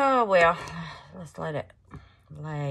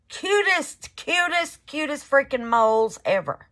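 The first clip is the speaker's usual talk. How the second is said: high, loud, repeated gush; a high, loud rave; gushing squeal; 8 semitones higher, 6 dB louder than usual. Real, not acted. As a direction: rave about the cute moles. Gushing over the molds.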